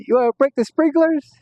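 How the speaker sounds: high, gleeful dare; excited dare. Retold or imitated. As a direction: toss it out with a grin. Friend repeats the proposal.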